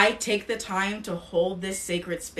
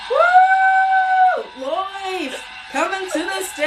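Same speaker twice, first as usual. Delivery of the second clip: much higher, louder host cheer; announcer shout; 11 semitones higher, 8 dB louder, slower than usual. Real, not acted. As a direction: bring the guest on with a big whoop. Announces guest to stage.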